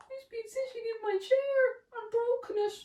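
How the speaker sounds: high, fast squeaky cry; squeaky little bear. A narrator's reading. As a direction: wail it like a tiny bear. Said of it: "cried the little bear".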